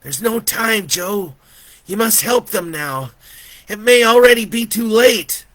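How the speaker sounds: put-on urgent child, high and loud; high, insistent boy's cry; little boy's voice. A narrator's reading. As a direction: plead urgently as a small boy. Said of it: "that little boy's voice".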